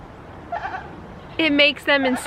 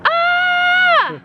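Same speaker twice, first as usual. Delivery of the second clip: high shrieking goat bleat, much louder; goat scream imitation; 4 dB louder than usual. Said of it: "She goes".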